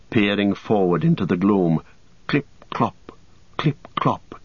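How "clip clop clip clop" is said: hushed, unvoiced hoofbeat sound; whisper. A narrator's reading. Narrator whispers the rider's approach. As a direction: breathe it as tension mounts.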